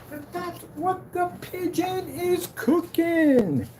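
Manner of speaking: high, very slow showman's call; drawn-out catchphrase. Acted. Streamer showing off his pigeon catchphrase, high drawn-out call. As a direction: stretch every word high and soft.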